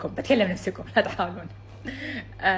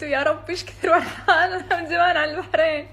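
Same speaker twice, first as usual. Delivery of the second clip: فرحة غامرة; 10 semitones higher, 6 dB louder than usual. Real, not acted. فرحانة بوصولها البحرين.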